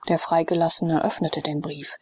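plain reading voice

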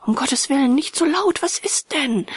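agitated plea to stop the noise; alarmed exclamation. Acted. Alarmed friend hushes the screamer.

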